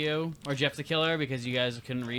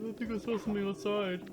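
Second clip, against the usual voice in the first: spooky voice; 8 semitones higher, 5 dB quieter than usual. Retold or imitated. Imitating friends spooking him.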